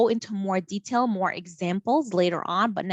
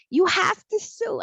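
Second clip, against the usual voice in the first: mom voice; 12 semitones higher, 3 dB louder, slower than usual. Retold or imitated.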